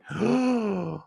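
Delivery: high, sudden sucked-in gasp; sharp gasp. Retold or imitated. Dreamer hit by the memory like a tsunami, gasping. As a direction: gasp as if it all hits at once.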